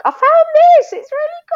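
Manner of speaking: very high bright-eyed trainer voice; imitating excited trainer. Trainer showing her a new gym toy, very high eager voice.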